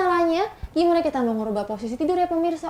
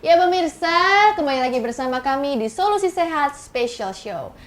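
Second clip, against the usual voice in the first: loud bright show-host opener; TV host welcome; 3 semitones higher, 4 dB louder than usual. Acted. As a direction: welcome the viewers back with energy. Host welcomes viewers back. (pembawa acara menyapa pemirsa dengan lantang)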